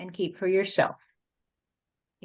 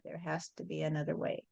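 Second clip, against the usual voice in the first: hushed echo; 4 semitones lower, 15 dB quieter, faster than usual. Retold or imitated. Echoing a desperate plea to God, hushed and low. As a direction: drop much softer and lower, almost to yourself. Repeated plea echoed softly.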